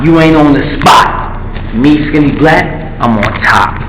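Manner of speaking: usual speaking voice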